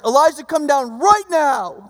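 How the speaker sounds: mock-stern, drawn-out command; scolding a six-year-old. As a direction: imitate a parent ordering a small child. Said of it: "Like he's talking to a six-year-old".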